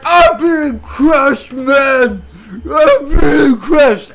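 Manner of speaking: high, drawn-out strained wail; loud, agonized, stretched-out yell; crushed man's cry. Retold or imitated. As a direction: cry out slowly as if pinned under weight. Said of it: "And Louie's like".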